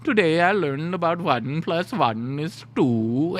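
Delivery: trolling, hammed-up Indian accent; exaggerated Indian accent, slowing and swelling at the end; mock Indian accent. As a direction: mimic the tutor's Indian accent to amuse the parents. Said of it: "I would be such a troll".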